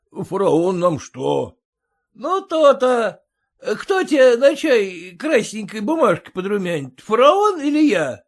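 loud, high bragging; swaggering merchant. A narrator's reading. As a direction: boast over the coachman. Merchant presses the coachman.

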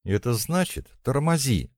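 high, urgent cry at contained volume; hysterical shout. A narrator's reading. Natka insists the driver brake, shouting with rising hysteria. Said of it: "крикнула Натка, заражаясь общим истерическим состоянием".

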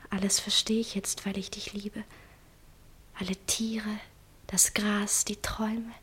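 hushed, dreamy whisper. Acted. Sabine confessing her love to Jan, whispered. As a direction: whisper it as if in wonder.

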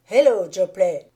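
a loud, hearty greeting out of nowhere; loud exclamation. A narrator's reading. Hannay startles Jopley with a loud greeting. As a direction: call his name out loud as you grab him. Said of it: "m'exclamai-je".

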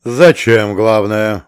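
mildly outraged commander; indignant. A narrator's reading. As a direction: throw the question back in protest. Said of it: "возмутился командир".

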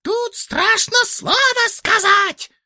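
very high, slow squeak; squeaking beak. Acted. The bird's beak squeaks back at grandfather. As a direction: squeak it tiny and shrill like a bird. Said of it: "пискнул птичий нос".

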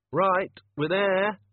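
high, slow, loud call; drawn-out shout from up top. A narrator's reading. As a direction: call down to someone far below. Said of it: "Susan shouted down".

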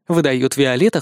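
usual speaking voice

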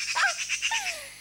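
high gleeful giggle; triumphant girlish laugh. Acted. Das Mädchen lacht triumphierend über den Traum – schadenfrohes Kichern. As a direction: laugh in triumph over the rival.

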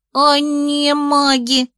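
put-on high, slow squeaky little boy; squeaky toy. A narrator's reading. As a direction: squeak it out like a small kid. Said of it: "пропищал мальчишка".

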